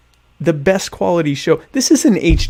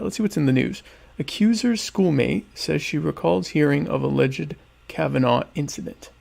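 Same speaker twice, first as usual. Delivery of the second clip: ordinary talk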